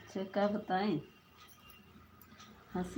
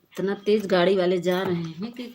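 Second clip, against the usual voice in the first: much louder, urgent outcry; loud alarmed yell; alarmed shout; 12 dB louder than usual. Real, not acted. Alarmed at speeding vehicles.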